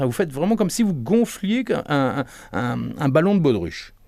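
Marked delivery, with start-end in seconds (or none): none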